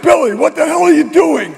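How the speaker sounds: shocked father. Acted. Father catching his son, shocked. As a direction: raise the pitch in outraged surprise.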